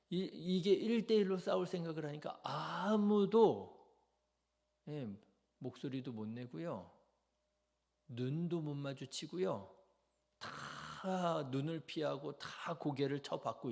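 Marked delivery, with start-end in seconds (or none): none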